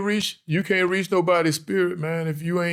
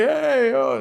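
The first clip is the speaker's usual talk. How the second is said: a much higher, bright-eyed "Hey"; cartoonishly chummy hello; mock overfriendly greeting; 10 semitones higher than usual. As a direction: play the too-eager friendly guy. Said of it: "am I just friendly?".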